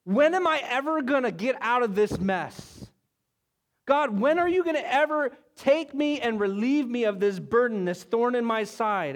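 a weary believer crying out to God, pleading; desperate prayer. Acted. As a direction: plead like someone stuck in a mess. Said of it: "It could be a statement or a question".

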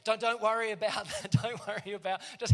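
laughing through the offer; host laughing as he insists. Retold or imitated. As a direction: chuckle while pressing the food on him. Host's generous offer, laughing.

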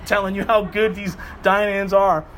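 usual speaking voice

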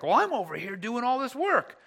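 hammed-up resentful volunteer; mock grumbling. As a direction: whine about doing all the work. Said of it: "start grumbling in our heart".

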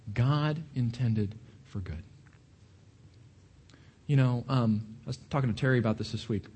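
ordinary talk, nothing marked

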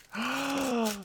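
high, sharp gasp of delight. Real, not acted. Friend opens a present, delighted high gasp. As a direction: gasp at the gift. Opening gift.

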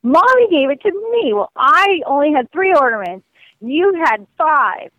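put-on high, whiny kid voices arguing; children bickering, high and pleading; squabbling kids. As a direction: play the kids fighting over ornaments. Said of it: "fighting over".

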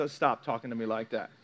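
low, soft aside as an unimpressed grown-up; mock protest, dropped low and quiet; quietly put-off listener; imitated listener objection. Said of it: "see some of y'all like".

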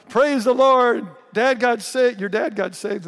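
loud, high, joyful exclaiming; fired up. Retold or imitated. Stepmother at the reception celebrating dad's salvation, loud and thrilled. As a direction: retell the stepmother bursting with joy. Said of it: "my stepmother just fired up".

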